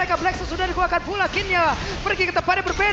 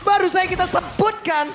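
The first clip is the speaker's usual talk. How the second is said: building to a loud triple-kill shout; excited play-by-play; 4 semitones higher, 4 dB louder than usual. Real, not acted. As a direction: hype it up as the kill lands. Caster calls Maybe's triple kill. (teriakan komentator yang makin bersemangat)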